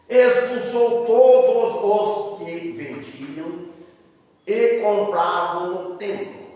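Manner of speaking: slow, measured Bible reading, phrase by phrase; declaimed scripture reading. Acted. Preacher reading the temple cleansing to the church, slow declamation. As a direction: proclaim each phrase deliberately, drawing out the words. Reads scripture aloud to congregation.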